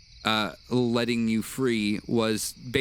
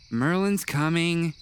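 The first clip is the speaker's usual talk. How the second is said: slightly raised, slow NPC voice; a touch of Skippy; 7 semitones higher, slower than usual. Acted. Skippy tells the party Merlin is coming, lightly voiced and slow. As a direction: lightly voice the creature announcing him.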